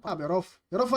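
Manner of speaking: normal talking voice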